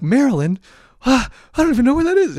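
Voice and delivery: high, loud, rushed disbelief; freaking out. Retold or imitated. New student to his roommate from Maryland, freaking out. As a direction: panic at a place you've never heard of. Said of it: "I'm just freaking out".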